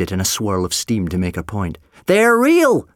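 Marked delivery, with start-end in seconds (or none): 2.0-2.9 high, loud protest; indignant, raised voice; insistent old man. A narrator's reading. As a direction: assert it hotly across the room. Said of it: "the man said again".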